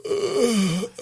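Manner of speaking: high, soft yawning groan; mock yawn. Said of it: "he yawns and he goes".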